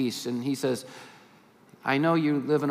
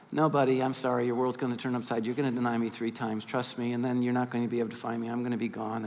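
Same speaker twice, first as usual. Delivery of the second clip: softer and lower, a kindly letdown; gentle Jesus; 5 semitones lower, 6 dB quieter, faster than usual. Retold or imitated. Jesus gently corrects the boastful Peter, a soft kindly voice. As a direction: let him down gently, like a friend.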